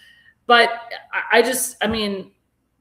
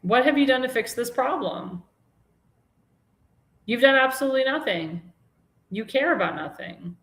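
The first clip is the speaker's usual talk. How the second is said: indignant; 4 semitones higher than usual. Real, not acted. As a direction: demand answers from the governor. Host berates the governor.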